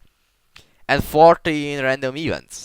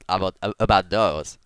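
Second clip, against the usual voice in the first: normal talking voice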